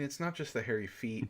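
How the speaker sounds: normal talking voice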